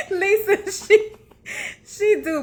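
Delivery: high and laughing through the words; laughing tease. Real, not acted. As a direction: tease her friend while cracking up. Laughing teasing a viewer.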